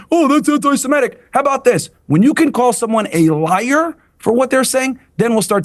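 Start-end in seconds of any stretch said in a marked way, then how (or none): 0.1-1.2 high mocking whine. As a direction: parody a pearl-clutching accuser. Mock quote of the rabbi.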